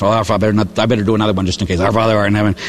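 mumbled rote praying at speed, pitched low; rattled-off prayer. Retold or imitated. Penitent adding another Our Father just in case, rattled off. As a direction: gabble it to get through the count. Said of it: "say these prayers really, really fast".

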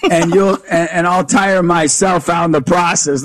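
ordinary talk

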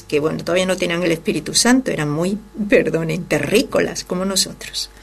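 ordinary talk, nothing marked

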